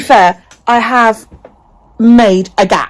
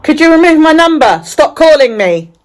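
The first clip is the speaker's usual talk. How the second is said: loud, exasperated order to stop calling; sharp fed-up complaint into the phone; fed-up demand; 6 semitones higher, 5 dB louder than usual. Real, not acted. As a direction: tell the robocaller off, high and loud. Telling robocall to stop.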